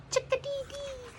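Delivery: very high chirped call; chickadee chirp. Retold or imitated. Narrator repeats the chickadee call, high chirp. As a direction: chirp like a small bird.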